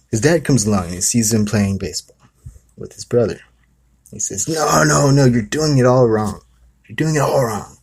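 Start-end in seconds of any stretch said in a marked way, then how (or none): 4.5-7.8 higher, louder mocking scold; mocking dad. Dad mocking his son at baseball, higher and louder. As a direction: ridicule the kid's attempt. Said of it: "Starts mocking him".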